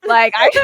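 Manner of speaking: high loud laugh breaking out; burst of laughter. Real, not acted. Telling friends the vomit story, bursts out laughing. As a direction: crack up at the memory.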